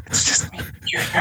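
ASMR whisper; hushed mock-intimate murmur. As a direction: whisper close and confidential. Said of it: "Whisper, motherfucker!".

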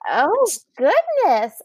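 drawn-out stunned reply; slow, taken-aback oh goodness; slow shocked oh goodness. Retold or imitated. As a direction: stretch it out, aghast. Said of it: "and I was like".

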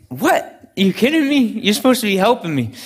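high, outraged "are you kidding me"; indignant disbelief. Retold or imitated. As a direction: squeal it out in offended shock. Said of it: "And I'm like".

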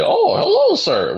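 very high, squeaky cartoon demon impression; fat demon voice. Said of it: "the fat demon from Hercules".

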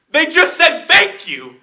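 high, drawn-out mock whine; retold protest. Customer objecting to store worker, high mock protest. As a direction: imitate an exasperated customer, very high and slow.

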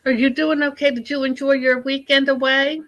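normal talking voice

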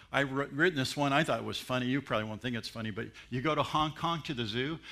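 normal talking voice

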